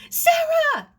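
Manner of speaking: put-on frantic girl calling from the cliff path, shrill and loud. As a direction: shriek for help across the garden. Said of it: "The voice was frantic".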